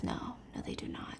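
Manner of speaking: very soft, near-whispered answer; hushed reply. Acted. Juliet answering her cellmate after a nightmare, hushed. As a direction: barely voice it. Voices the frightened heroine's reply.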